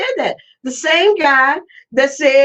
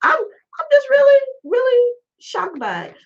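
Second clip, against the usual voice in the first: shocked cry; 11 semitones higher than usual. Real, not acted. Shocked by Robinson's words.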